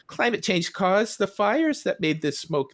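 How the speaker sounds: high-pitched cartoon caption reading; cartoon character. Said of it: "the couple talking to each other".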